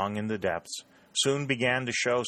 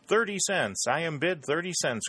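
high, raised bid call; auctioneer's cry; 8 semitones higher than usual. A narrator's reading. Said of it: "cried Talbot".

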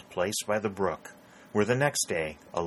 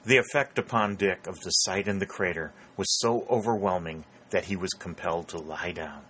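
plain reading voice

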